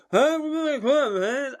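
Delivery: high slurred mock drunk; drunk customer. Drunk customer ordering at the bar, high mock voice. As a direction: imitate a drunk punter. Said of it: "probably going".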